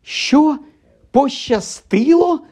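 high-pitched outraged disbelief; drawn-out, high incredulous squeal; indignant shriek. A narrator's reading. Hermione shocked that Ron calls it luck, shrieking. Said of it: "заверещала Герміона".

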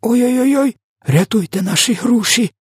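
very high-pitched wail of woe; distressed lamenting. A narrator's reading. Giant finds a nightmare in the jar, high woeful lament. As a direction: wail in hammed-up dismay. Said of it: "бідкався В Д В".

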